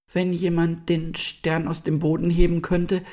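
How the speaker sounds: very high, small fawn voice; soft little voice. A narrator's reading. Young deer offers an idea to the animals, soft little voice. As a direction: pipe up timidly, high and small. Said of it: "sagte ein leises Stimmchen".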